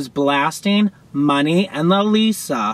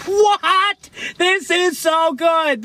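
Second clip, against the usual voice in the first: stunned delight; 10 semitones higher, much slower than usual. Real, not acted. Fan stunned by the choreography, high amazed cry. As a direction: shoot up high, then savour it slowly.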